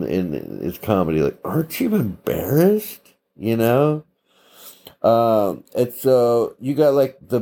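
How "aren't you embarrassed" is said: high, incredulous comedian's jab; pitched up, disbelieving scold; Maniscalco impression. Vlogger quotes Sebastian Maniscalco's bit, high incredulous jab. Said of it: "Sebastian Maniscalco said".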